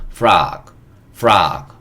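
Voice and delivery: frog with an "ah" vowel, "frahg"; English pronunciation demo. Shown as a demonstration. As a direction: model frog as a drawn-out word, said twice. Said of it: "이렇게 얘기합니다". (frog를 '프라그'처럼 영어식으로 시범 발음)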